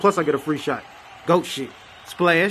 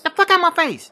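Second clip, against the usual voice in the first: high, loud yell; angry shout; 12 semitones higher, 4 dB louder than usual. Acted. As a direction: bark it out, much higher and louder. Mother snapping at interviewer.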